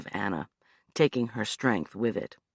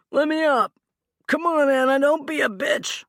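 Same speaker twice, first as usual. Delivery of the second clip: high, loud, strained man's voice; begging and griping from the ground; pinned man pleading; 13 semitones higher, 6 dB louder than usual. Acted. Pinned Hugh begging to get up.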